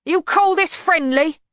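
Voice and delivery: loud, high, slow protest; high, strained-sounding complaint; indignant bogeyman. A narrator's reading. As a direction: cry out in pained outrage. Bogeyman protests while held.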